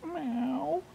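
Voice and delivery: high, quiet mew; soft cat meow. A narrator's reading. Rescued cat answering boy's whisper, soft meow. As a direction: mew softly up high, lifting at the end.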